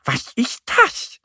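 very high, drawn-out question; high, stretched puzzled cry; Annie calling out. A narrator's reading. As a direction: call out slowly in a high duck voice. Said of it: "ruft Annie und".

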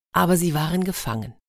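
ordinary delivery, nothing marked

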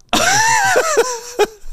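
high, shouted burst of laughter; loud shrieking laugh. Real, not acted. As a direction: let out a wild howling laugh. Big laugh at funny memory.

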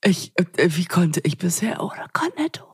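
gehauchtes, staunendes Flüstern; ehrfürchtig flüsternd. Pretend. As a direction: wie eine Offenbarung flüstern. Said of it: "dass ich sagen würde". (flüsternd vor Staunen)

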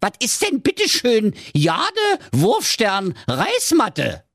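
put-on high, slow Berlin accent, puzzled; baffled Berlin ninja. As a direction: spell out your confusion. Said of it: "fragte der Ninja".

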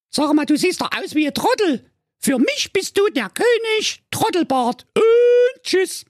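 sehr hohe Mädchenstimme, am Ende langsam; verhöhne ihn als Trottel; spöttische Prinzessin. Acted. Prinzessin verspottet Freier. (die Prinzessin verspottet den König mit dem schiefen Kinn)

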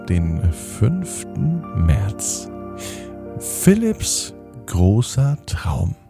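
slow, drawn-out story title; slow title read. Acted. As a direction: announce the title slowly.

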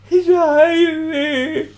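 high, loud, slow crying wail; tearful wail. Retold or imitated. Homesick boy cries to Tilly on the phone, wailing.

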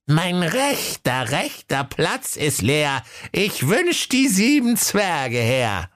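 very high, slow, theatrical wizard chant. A narrator's reading. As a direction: intone the spell grandly, slowly. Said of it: "Der mächtige Zauberer". (langsamer, hoher Zauberspruch des Zauberers)

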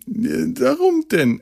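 drawn-out, very high despairing why; exasperated whine. Real, not acted. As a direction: stretch it out in disbelief. Exasperated over forced hugging. (verzweifelt gedehntes Warum)

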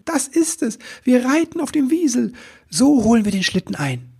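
very high, thrilled voice; full of enthusiasm. A narrator's reading. Elf plans to catch the sleigh by weasel, thrilled. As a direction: burst out high with a brilliant idea. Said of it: "rief er voller Begeisterung".

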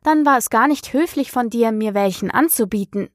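usual speaking voice

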